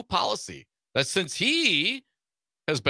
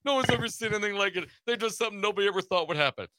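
higher, fast rattled-off imitation; Trump impression; 6 semitones higher, faster than usual. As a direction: rattle it off higher, mimicking a politician's talk. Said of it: "You know how he talks".

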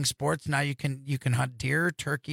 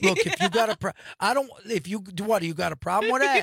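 tough New York Italian; 3 semitones higher than usual. Pretend.

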